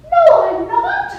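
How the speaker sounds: very high, loud mock voice; comic protesting queen. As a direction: pipe up in comic protest from the dead. Skit punchline, supposedly dead queen answers.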